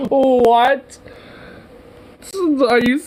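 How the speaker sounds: high-pitched, stunned outburst; incredulous. Real, not acted. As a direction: squawk it in sheer disbelief. Stunned by the official's question.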